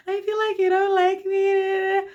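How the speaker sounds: high, slow, sobbing whine; hammed-up weepy drunk complaint; drunken crying whine. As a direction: wail it like a teary drunk.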